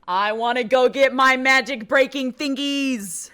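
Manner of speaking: comic full-volume repeat; playful yell. Pretend. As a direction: holler it back as if they didn't hear. Playfully repeats after 'what did you say'.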